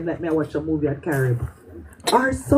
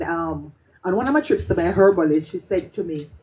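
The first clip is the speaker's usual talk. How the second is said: usual speaking voice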